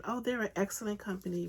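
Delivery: ordinary talk, nothing marked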